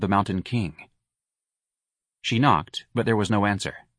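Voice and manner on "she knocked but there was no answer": ordinary delivery, nothing marked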